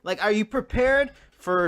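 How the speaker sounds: ordinary talk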